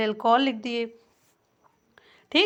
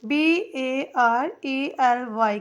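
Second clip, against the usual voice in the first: normal talking voice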